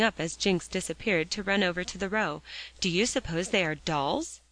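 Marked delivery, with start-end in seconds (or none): none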